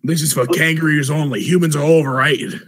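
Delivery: hammed-up Australian with loud scorn; gruff Australian accent. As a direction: announce it like a proud Australian kangaroo. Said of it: "in a gruff Australian accent".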